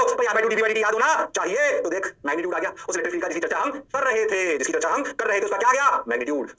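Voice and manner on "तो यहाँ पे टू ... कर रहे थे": ordinary delivery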